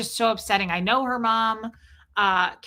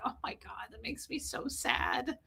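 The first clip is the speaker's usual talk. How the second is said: soft, quiet, barely voiced words of sorrow; hushed dismay; 3 semitones higher, 11 dB quieter than usual. Replying to a friend whose mother just died, hushed and quiet. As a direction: let it drop to almost nothing, stunned. Retelling her reply to sad news.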